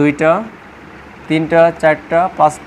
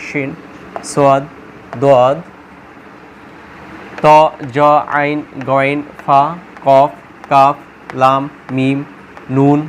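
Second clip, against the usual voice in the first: lower, even letter-by-letter recital; alphabet recitation; 4 semitones lower, slower than usual. Shown as a demonstration. Teacher reading out the Arabic letters for learners, measured recitation. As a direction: recite the Arabic letters one at a time, evenly. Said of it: "আলিফ আমি বলতেছি".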